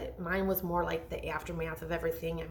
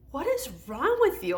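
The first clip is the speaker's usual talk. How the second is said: husband's exasperated question; 8 semitones higher, 4 dB louder than usual. Retold or imitated. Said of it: "My husband was just like".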